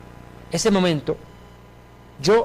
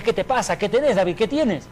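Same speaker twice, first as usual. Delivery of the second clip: imitating worried, higher-pitched questions; alarmed father; 6 semitones higher than usual. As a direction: fire questions as a startled father. Father alarmed at son's convulsions. (padre alarmado, imitado)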